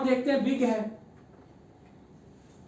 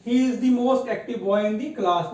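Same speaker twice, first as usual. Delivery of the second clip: put-on He is the most active boy in the class read very slowly and evenly, word by word; slow example reading; much slower than usual. Shown as a demonstration. Teacher reads the model sentence to the class, very slowly. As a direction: read the example slowly so pupils can follow. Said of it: "teacher reads example sentence".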